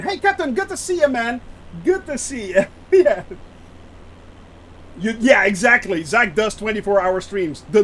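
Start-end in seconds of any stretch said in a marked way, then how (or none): 0.0-2.6 cheery greeting. Real, not acted. Greeting a viewer.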